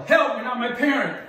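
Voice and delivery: loud, high shout for help; yelled call for help. Shown as a demonstration. Coach showing child how to yell for help, loud high yell. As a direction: yell it so the whole store hears. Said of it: "we're gonna yell".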